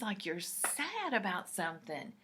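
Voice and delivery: ordinary delivery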